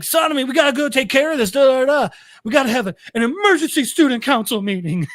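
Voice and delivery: a know-it-all pushing her agenda; self-important student. Retold or imitated. Maria telling Sonomi about council, fast and self-important. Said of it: "really capture the, uh, holier than thou".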